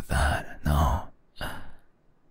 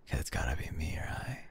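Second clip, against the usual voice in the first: soft teasing whisper; 11 dB quieter, faster than usual. Acted. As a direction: breathe it close to the ear. Teasing boyfriend leaning close.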